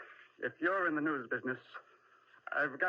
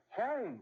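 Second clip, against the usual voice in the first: HOME, said much higher, hammered home; insistent repeat; 10 semitones higher than usual. Acted. Husband insists during quarrel.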